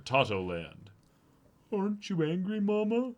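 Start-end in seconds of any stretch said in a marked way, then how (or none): 1.7-3.1 high timid helper voice; lightly voiced minion. Servant asking Mama if she is angry. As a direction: ask like a small nervous servant.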